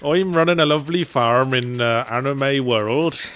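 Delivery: slow, drawling West Country farmer; West Country accent. Pretend. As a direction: play a jolly West Country farmer, drawn out. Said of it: "do the whole thing in a West Country accent".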